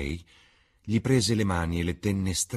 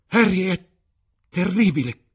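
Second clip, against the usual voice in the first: much higher and louder cry of horror; anguished cry; 13 semitones higher, 7 dB louder than usual. A narrator's reading. Dorian crying out to Harry at the death, loud and high. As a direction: cry it out, horrified. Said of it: "gridò il ragazzo".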